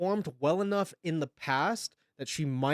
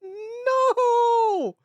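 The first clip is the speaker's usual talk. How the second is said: long high wailing no; anguished cry; 7 dB louder than usual. Real, not acted. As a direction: wail it out high and loud.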